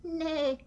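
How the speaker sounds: high neigh; whinny it high; horse neigh. Acted. Horse on the tractor, a high neigh. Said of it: "said Horse".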